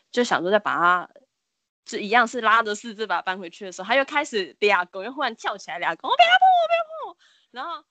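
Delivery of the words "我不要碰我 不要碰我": shrill, much higher panicked shriek; frenzied scream. Retold or imitated. Possessed boy leaping up and flailing at classmates, frantic scream. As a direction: shriek it as you leap up flailing.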